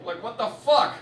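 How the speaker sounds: ordinary talk, nothing marked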